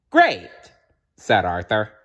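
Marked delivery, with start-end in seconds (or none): 0.1-0.5 high, loud delighted cry; excited. Acted. Arthur thrilled at landing a job, delighted cry. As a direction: burst out with joy.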